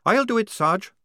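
high, loud questioning recruit; doubtful young recruit. Acted. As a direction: push back with doubt. Tonka volunteers.

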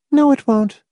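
high, level woman character voice; Miss Rice's voice. Acted. Miss Rice contradicting Hamilton, high character voice. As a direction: answer in her high-pitched voice.

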